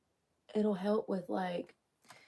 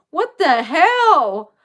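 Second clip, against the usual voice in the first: drawn out slowly, much louder and higher; exasperated outburst; 8 semitones higher, 11 dB louder, much slower than usual. Real, not acted. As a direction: let the disbelief burst out. Retelling the bad tattoo guy.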